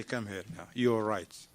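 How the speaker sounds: low, soft, gentle admission; soft conceding wife. Retold or imitated. Wife softly admits she was wrong. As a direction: give in quietly and tenderly. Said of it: "she finished, say".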